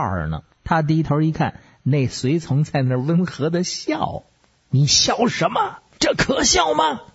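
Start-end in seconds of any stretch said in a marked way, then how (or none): 4.7-7.0 high-pitched flustered outburst; affronted, voice shooting up high; indignant snapping. Acted. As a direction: snap at someone for laughing at you. Rattled king snaps at smiling attendant.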